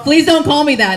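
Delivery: loud, high objection; mock pleading protest. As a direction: protest loudly to the class. Teacher protesting to her students.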